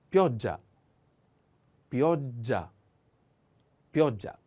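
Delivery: pioggia with stress on o and a strong double gg; slow teaching repetition. Shown as a demonstration. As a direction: model pioggia, lean on the o. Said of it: "The accent on o".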